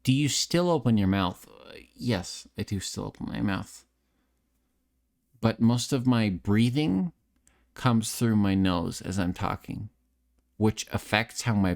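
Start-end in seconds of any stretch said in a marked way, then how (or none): none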